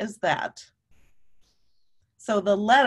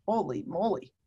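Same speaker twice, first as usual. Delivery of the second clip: holy moly surprise; 4 semitones lower, 5 dB quieter than usual. Real, not acted. Reaction to letter.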